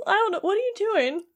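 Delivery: high, lightly acted confusion; bewildered Sora. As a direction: play baffled, pitch lifted. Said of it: "And Sora's just like".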